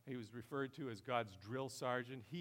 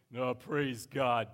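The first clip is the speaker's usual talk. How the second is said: louder, slow imitation of a pastor's blessing; Pastor Jerry's voice; 11 dB louder, much slower than usual. Imagining Pastor Jerry's easy praise, slow mock-pious voice. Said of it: "this is how he talks, too, with a voice like this".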